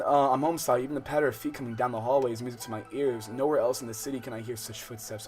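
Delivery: ordinary talk, nothing marked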